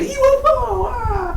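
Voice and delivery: put-on very high, childlike squeal; inner child squeal. Reviewer acts out viewers' tingling inner child, squealing high. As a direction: squeal it like an excited kid. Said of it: "Your inner child came out".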